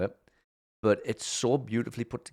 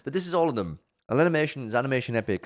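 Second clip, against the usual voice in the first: normal talking voice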